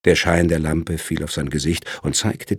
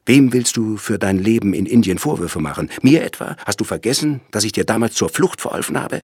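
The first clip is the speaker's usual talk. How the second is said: higher, louder indignant questioning; indignant reproach; 5 semitones higher, 5 dB louder than usual. A narrator's reading. Lawyer reminds his brother of past help, indignant. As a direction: throw the accusations at him.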